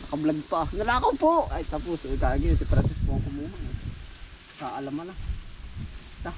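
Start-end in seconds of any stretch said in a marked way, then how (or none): 0.7-1.3 higher-pitched playful cry of theft; mock outcry. Vlogger jokingly accusing Kuya Francis of taking it, mock outcry. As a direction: call it out as a joking alarm.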